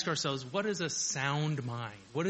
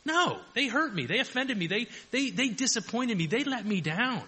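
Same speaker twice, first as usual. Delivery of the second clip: high, loud, aggrieved whine; natural mind's complaint; 8 semitones higher, 4 dB louder than usual. Retold or imitated. Preacher voicing the natural mind, high aggrieved whine. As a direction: play the hurt self-pity of the natural mind. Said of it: "The natural mind says".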